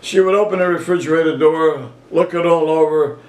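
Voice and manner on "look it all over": ordinary delivery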